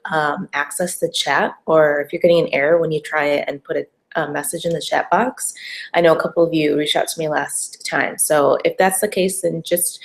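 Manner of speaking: ordinary talk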